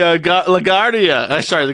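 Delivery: normal talking voice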